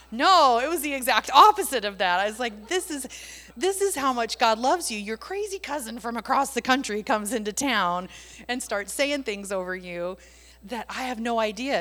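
big excited declaration; emphatic loud protest. Retold or imitated. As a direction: drown out the doubt with fervour. Emphatic reply to girl.

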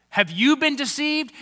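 angry retort. Acted. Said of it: "The leaders reply with anger".

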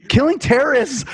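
high, disbelieving repeat of killing terrorists; incredulous. Real, not acted. Repeating the movie line to cohosts, incredulous. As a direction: throw the words back in disbelief. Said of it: "incredulous echo of quote".

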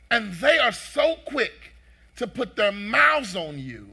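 forceful, emphatic sermon delivery; loud, driving preacher cadence; preaching. Real, not acted. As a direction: preach it with rising pitch and punched key words. Preaches to congregation.

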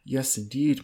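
plain reading voice

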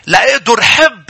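high, emphatic sermon delivery; fervent preaching. Acted. Preacher explaining love to the people of God, fervent.

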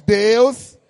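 a firm spoken Deus; strong call. Shown as a demonstration. Pastor asks church to say it strong, firm call. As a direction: say Deus firmly out loud. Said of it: "fala bem forte".

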